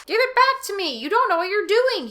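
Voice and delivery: high, loud, desperate plea; pleading. Acted. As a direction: beg her for the axe. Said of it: "he pleaded".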